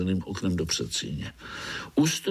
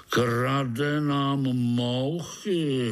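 very drawn-out, higher-pitched complaint; hollering old spider; 5 semitones higher, much slower than usual. A narrator's reading. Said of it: "hulákal starý pavouk". (táhlé hulákání starého pavouka)

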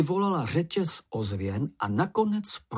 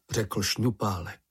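plain reading voice

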